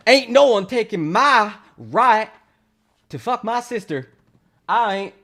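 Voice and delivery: mock Texan good ol' boy. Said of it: "Fucking Texans!".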